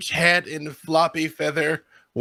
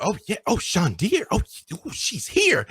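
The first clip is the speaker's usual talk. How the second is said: mock-excited, pitched high; imitated excitement; 9 semitones higher than usual. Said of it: "you turn around with excitement".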